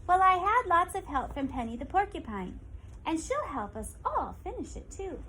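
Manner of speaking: put-on old woman voice, pleased; proud old woman. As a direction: praise Penny to the animals. Old woman praises Penny.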